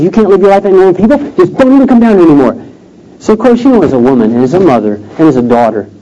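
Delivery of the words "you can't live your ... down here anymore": higher, quick voice of an imitated grandmother; lightly acted grandmother, pitched up and brisk; retold grandmother. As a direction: rattle it off as the grandmother laying down the law. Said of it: "your grandmother says".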